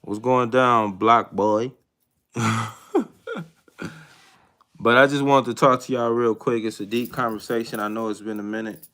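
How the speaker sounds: ordinary talk, nothing marked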